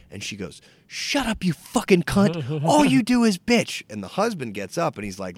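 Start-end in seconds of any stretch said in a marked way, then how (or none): 0.9-3.8 put-on high, loud old-lady tirade; imitated abusive outburst. Old lady to a diner who objected, abusive. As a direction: lay into them in a mock shrieking rage.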